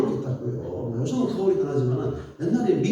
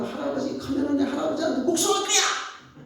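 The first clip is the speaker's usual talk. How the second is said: higher kid's voice, a mild mock vow of revenge; angry child's threat; 8 semitones higher than usual. Young boy vowing revenge on his grandfather, mock childish threat. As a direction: play a sulking boy swearing payback. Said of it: "내가 막 그랬다니까요".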